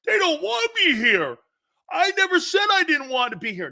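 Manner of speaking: high-pitched, moaning mock whine; mock whining. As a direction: imitate an aggrieved player whining, pitched well up. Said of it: "He calls in whining ... he's moaning".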